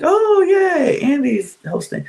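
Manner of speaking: high fake-excited mock cheer; sarcastic cheer. Vlogger mocking fans of Andy Cohen, sarcastic high cheer. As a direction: fake the delight, high and hollow. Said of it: "not gonna be one to be like".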